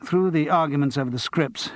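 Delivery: plain reading voice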